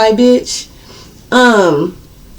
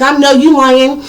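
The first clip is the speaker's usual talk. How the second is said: disbelieving mom; 5 semitones higher, 3 dB louder than usual. Retold or imitated. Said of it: "She's like".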